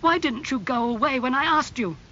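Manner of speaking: high, loud reproach; acted angry protest. A narrator's reading. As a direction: indignantly take the visitor to task. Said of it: "he asked angrily".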